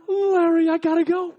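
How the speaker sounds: high, loud retold sign-off; urgent phone goodbye. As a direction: act out calling down the phone under threat. Acts himself on phone, held up.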